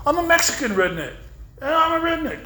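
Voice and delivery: high-pitched defiant comeback; retold insistence. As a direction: fire back the punchline, pitch way up. Triumphant comeback in retold quarrel.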